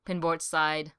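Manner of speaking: ordinary delivery, nothing marked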